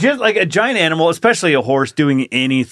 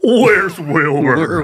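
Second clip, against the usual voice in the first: slow, drawn-out horse voice; Mister Ed-style horse drawl; talking horse; 2 semitones higher, much slower than usual. Acted. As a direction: stretch it into a horsey neigh. Plays Mister Ed asking for Wilbur.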